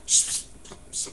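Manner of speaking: repeated short shushes; hushing shh. Acted. Speaker silences the voice of doubt, hushing.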